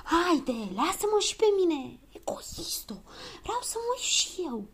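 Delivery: urgent, high stage whisper; pleading whisper. A narrator's reading. Child begging for a turn at the keyhole, whispering. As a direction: whisper a drawn-out plea for a turn. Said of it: "Se auziră din nou șaptele".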